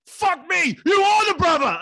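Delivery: high, loud startled yell; stunned cabbie bellowing in recognition; shocked shout. Retold or imitated. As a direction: shout it as the penny drops, voice shooting up. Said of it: "and he goes".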